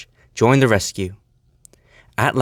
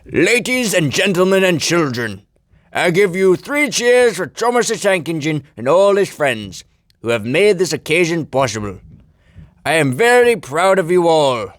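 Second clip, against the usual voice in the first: higher, grand public speech voice; proud Fat Controller; 8 semitones higher, 4 dB louder than usual. A narrator's reading. Fat Controller calling three cheers at the station, proud. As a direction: address the crowd proudly in character. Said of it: "The Fat Controller proudly said".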